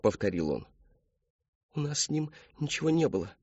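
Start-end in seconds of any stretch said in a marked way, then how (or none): 1.8-3.3 put-on higher, small young voice; daughter's denial. A narrator's reading. Daughter denying it to her father, high small voice. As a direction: deny it timidly, pitched up.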